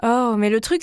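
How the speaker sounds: plain reading voice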